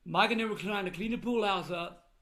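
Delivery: ordinary talk, nothing marked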